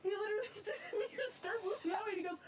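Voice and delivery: high, breathless, giddy laughter through the words; laughing excitement. Real, not acted. Rail fan telling the camera after driving the engine, giddy laughter. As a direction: gush it out while laughing.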